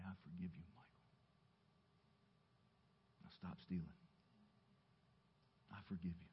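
very soft, hushed forgiveness; inaudible inner voice. Retold or imitated. God forgiving the boy in his heart, hushed. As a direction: murmur it gently, barely aloud. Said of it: "Not audibly, but in my heart of hearts".

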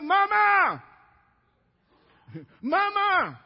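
loud, high holler for mama; calling out. Acted. Healed girl calling her mother from upstairs, loud high call. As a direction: shout it through the house.

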